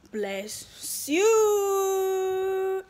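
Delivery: "you" held long and high; exuberant blessing. Real, not acted. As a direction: let the blessing soar into a long, loud cry.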